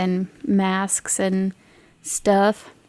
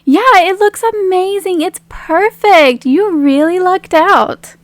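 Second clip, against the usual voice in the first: higher, slower, encouraging friend; admiring Meg; 7 semitones higher, 4 dB louder than usual. Acted. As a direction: reassure her the dress is perfect. Plays Meg in character.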